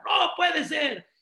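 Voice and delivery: mock father yelling in anger; hammed-up furious father; shouting. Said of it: "empezó a gritar".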